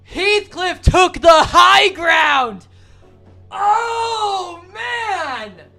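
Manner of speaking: slow, loud triumphant yell; excited shout. Real, not acted. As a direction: bellow it out in delight.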